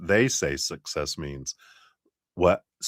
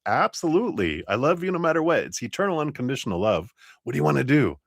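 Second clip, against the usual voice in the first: higher, bright-eyed loving creator; 8 semitones higher, faster than usual. Retold or imitated. Creator answering our question, higher and loving. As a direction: sing out unconditional love like a doting creator. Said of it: "and creator's like".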